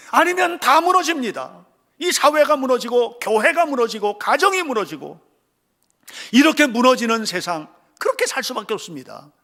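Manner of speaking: forceful warning. Real, not acted. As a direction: hammer the collapse home. Warning of collapse. (강하게 경고하듯)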